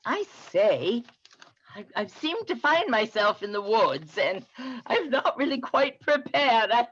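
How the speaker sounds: a light posh English gent lost in the woods; English accent. Acted. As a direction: play a cheerful Englishman making the best of it. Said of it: "you tend to have a Southern and an English accent".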